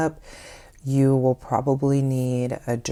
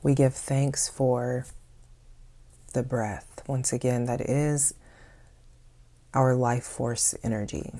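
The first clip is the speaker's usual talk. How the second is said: unhurried meditation voice, long pauses between phrases; guided meditation. Acted. Host leading listeners to notice their breathing, guided meditation voice. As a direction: guide them calmly into the breath, leaving space after each phrase.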